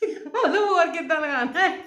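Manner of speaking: laughing through the words. Real, not acted. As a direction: giggle out a disbelieving question. Laughing at mom's coffee remark.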